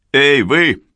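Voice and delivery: loud calling yell. A narrator's reading. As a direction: bark it across the distance. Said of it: "заорал Белл".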